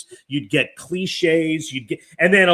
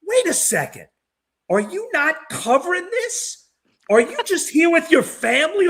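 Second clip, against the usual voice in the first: surprised; 11 semitones higher than usual. Retold or imitated. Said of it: "and he went".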